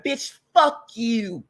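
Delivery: ordinary talk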